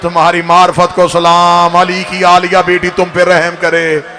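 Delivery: loud, ringing mourning address; loud lamenting recital; impassioned preaching. Acted. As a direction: cry it out to the gathering. Loud preached quote of Sajjad.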